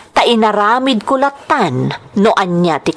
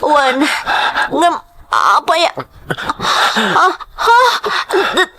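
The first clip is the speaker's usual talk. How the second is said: higher, broken gasps and cries; moaning in pain; 4 semitones higher, slower than usual. Acted. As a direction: gasp at the sight of blood. Bleeding woman moans in pain.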